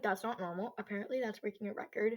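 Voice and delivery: normal talking voice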